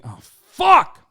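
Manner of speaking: sudden high, loud curse; explosive swearing outburst; real frustrated yell. Real, not acted. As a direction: burst out shouting in frustration. Sudden curse breaking off mid-sentence.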